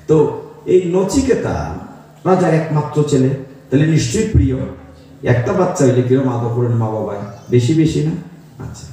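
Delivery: ordinary talk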